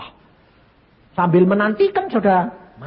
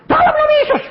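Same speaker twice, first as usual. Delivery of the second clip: high, loud laughing rebuke; 7 dB louder, much faster than usual. Retold or imitated. Man rebuking the current again, loud and laughing. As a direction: command it, half laughing at yourself. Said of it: "aku bilang gitu".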